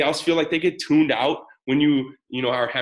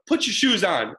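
higher-pitched, half-hearted yell; mild mock yell; 6 semitones higher than usual. Parent before a walk, mildly yelling. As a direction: imitate calling out an order. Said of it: "and I'm yelling".

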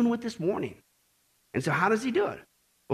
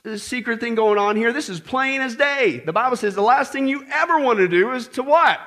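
ordinary delivery

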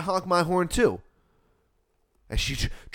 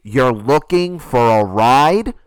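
slow, high, building to very loud; outraged disbelief; 5 semitones higher, 8 dB louder, slower than usual. Retold or imitated. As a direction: stretch each question out, incredulous, then blow up.

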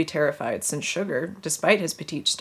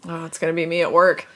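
ordinary talk

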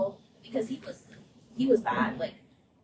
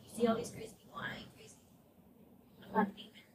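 hushed paranoid; 2 semitones higher, 9 dB quieter, faster than usual. Retold or imitated. Brother's paranoid words in psych ward.

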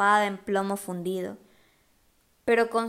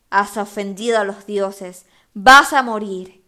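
slow, loud booming threat; voice from beyond the grave; 10 dB louder than usual. A narrator's reading. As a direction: boom it like a monster from the grave. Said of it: "con tono de ultratumba".

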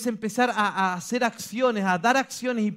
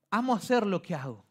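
ordinary talk

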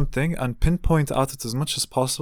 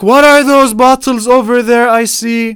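much higher and louder mock whine, slow; mocking the uncle; 13 semitones higher, 17 dB louder than usual. Mocking the uncle asking about the wine bottles, loud high whine. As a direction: screech it like a nagging busybody. Uncle's nosy question mocked again.

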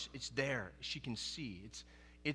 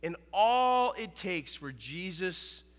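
normal talking voice

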